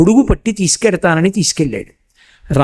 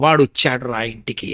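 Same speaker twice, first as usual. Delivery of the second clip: hushed rapture; 6 semitones lower, 6 dB quieter than usual. Acted. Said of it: "ఈశ్వరుడు పరవశించిపోతాడు".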